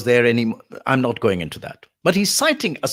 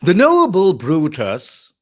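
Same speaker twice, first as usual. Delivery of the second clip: "noble" pitched up high and stretched; stress on noble; 4 semitones higher, 6 dB louder, slower than usual. Shown as a demonstration. Lecturer demonstrating Antony's speech, "noble" raised in pitch. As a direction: go up loudly on "noble", slowly. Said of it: "why did I go up on noble".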